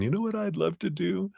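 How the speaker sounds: high, soft wistful wish; dreamy heart. Retold or imitated. Heart softly and highly confiding its dream. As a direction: whisper a cherished dream upward. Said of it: "heart's eye going".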